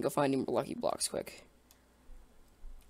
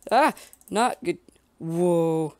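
alarmed; 3 semitones higher, 8 dB louder, much slower than usual. Real, not acted. Big cat attacks.

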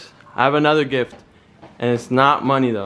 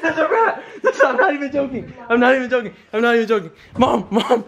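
much higher mock panic, breaking into laughter; fake rat panic; 11 semitones higher than usual. Son keeps the fake rat prank going, laughing mock panic. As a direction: fake fear you can't keep a straight face through. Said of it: "I'm gonna pretend that there's a rat in here".

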